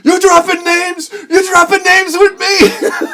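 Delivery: high, loud outraged shout; high, loud, laughing mock shout; furious old man. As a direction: bellow at the kid like an insulted old man. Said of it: "the old guy gets so mad".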